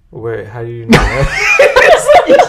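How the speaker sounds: slow, loud mock shock; laughing retold surprise; imitating surprise. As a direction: drag it out astonished. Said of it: "he was like".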